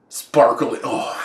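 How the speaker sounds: sneering drawn-out mockery; mocking. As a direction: sneer the word with contempt. Mocking sparkly vampires.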